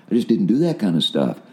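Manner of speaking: normal talking voice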